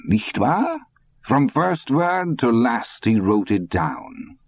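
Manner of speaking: put-on lightly accented old Nazi telling his plan; old German man. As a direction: savor each word like a sly old man.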